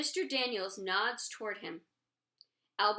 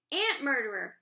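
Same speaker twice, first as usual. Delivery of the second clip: loud, higher accusing yell; screaming; 4 semitones higher, 7 dB louder than usual. A narrator's reading. Oliver pointing at Shay, a loud accusing yell. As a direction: point and shout it again. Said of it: "there is screaming".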